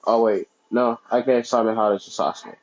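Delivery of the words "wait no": usual speaking voice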